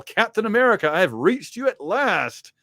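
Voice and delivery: high, loud dramatic call; off-panel voice. Acted. Unseen newcomer hails Captain America from off panel, dramatic call. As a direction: call out theatrically to someone at last found. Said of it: "we hear a voice from off panel".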